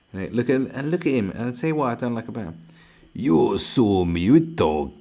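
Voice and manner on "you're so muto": very slow, loud fake Spanish crooning; a loud hammed-up Spanish accent, drawn out; mock Spanish accent. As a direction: stretch every word like a parody singer. Mocking Spanish-speaking streamer.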